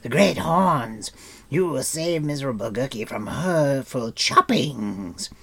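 cower and plead; frightened creature. A narrator's reading. Gurgi begging protection, loud and fearful.

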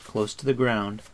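ordinary delivery

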